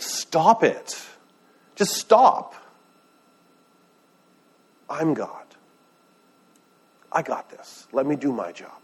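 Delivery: a fed-up God cutting the panic short; exasperated God. Retold or imitated. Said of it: "God's like".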